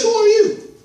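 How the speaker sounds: voiced high and bright as the scarecrow; preacher playing the scarecrow, high voice. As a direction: pitch it up as the scarecrow asking. Preacher voicing scarecrow's question.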